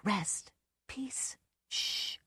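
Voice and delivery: a soft soothing whisper; a gentle shushing whisper; hushing whisper. A narrator's reading. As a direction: whisper him down to calm. Hushing a drunk man.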